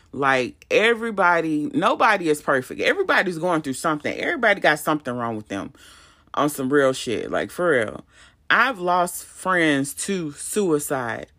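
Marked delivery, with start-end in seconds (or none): none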